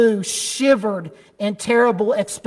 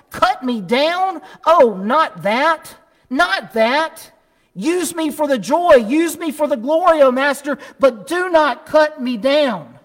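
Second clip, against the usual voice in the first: horrified pleading; 5 semitones higher than usual. Acted. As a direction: plead in disbelief, voice lifted. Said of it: "A trembling of great horror shook bamboo".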